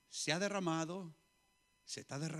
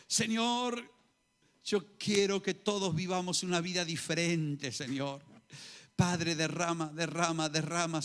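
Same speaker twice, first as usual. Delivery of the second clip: louder fervent prayer cries; preaching at full force; 8 dB louder than usual. Acted. Pastor leading the church in loud fervent prayer. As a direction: pour it out to the Lord with fire.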